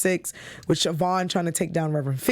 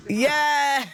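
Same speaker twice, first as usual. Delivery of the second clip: excited cheer; 7 semitones higher than usual. Real, not acted. Host cheering her segment drop, excited. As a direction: cheer the catchphrase landing.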